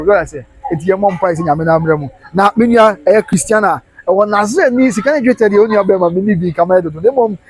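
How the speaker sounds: ordinary talk, nothing marked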